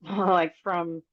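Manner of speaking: real nervous laugh breaking into the word; uneasy, involuntary chuckle. Real, not acted. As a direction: let a nervous laugh slip out as you start. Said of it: "I don't know why I'm laughing, probably 'cause I'm nervous".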